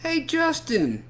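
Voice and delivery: high, slow call across yard. Acted. Boy calling a friend from the tetherball court. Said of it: "Emilio calls out".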